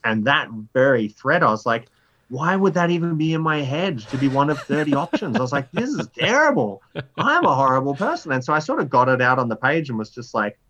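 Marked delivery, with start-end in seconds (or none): 2.3-8.3 higher, mock-horrified self-scolding; appalled at himself. A new dad horrified at his own thoughts, mock-appalled. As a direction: recoil from your own idea.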